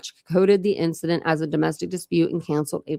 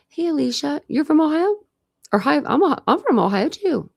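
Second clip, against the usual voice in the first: bright, higher friendly door-knock chat; casual, higher cop making friendly small talk; friendly officer small talk; 9 semitones higher than usual. Pretend. Imagined officer chatting at the door.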